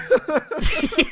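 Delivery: loud high burst of laughter; high-pitched helpless laugh; big laugh. Real, not acted. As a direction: crack up loudly at the memory. Laughter continues.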